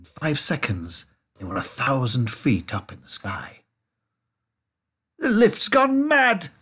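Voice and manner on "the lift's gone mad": high, slow, stretched wail; frightened, pitched-up holler; panicked shout. A narrator's reading. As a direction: cry out in alarm. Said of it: "shouted Grandpa Joe".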